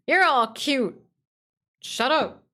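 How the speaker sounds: high, loud cutesy squeal; squeaky cute voice. Pretend. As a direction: squeak it like a toy.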